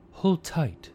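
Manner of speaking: high, loud urging; urgent call. A narrator's reading. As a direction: urge him on before the charge. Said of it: "he urged Pharaoh".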